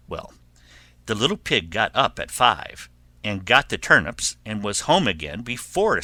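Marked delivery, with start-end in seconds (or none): none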